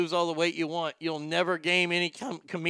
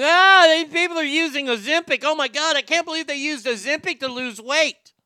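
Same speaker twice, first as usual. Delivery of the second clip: mocking whiny quote; 6 semitones higher, 6 dB louder than usual. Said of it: "all the people coming out and going".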